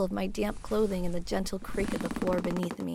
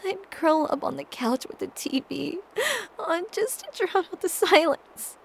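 sobbing; 10 semitones higher, 8 dB louder than usual. Acted. Breaking down over the emptiness.

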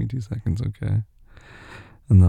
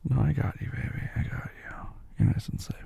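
soothing; 3 semitones higher, 5 dB quieter than usual. Acted. Comforting sleepy partner.

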